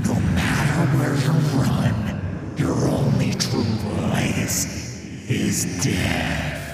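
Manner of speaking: slow rasping ghostly whisper; a sinister wraith savouring torment; cold hissing layered whisper. Acted. Thresh's voice.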